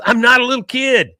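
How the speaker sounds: put-on high, loud kid protest; mock whiny protest. Bobby protesting to his parents, loud whiny mock. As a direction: whine it like an insulted boy.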